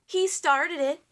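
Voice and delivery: high, loud accusing cry; king crying out high and loud; cried out. Acted. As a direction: blame the dragon loudly. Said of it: "he cried".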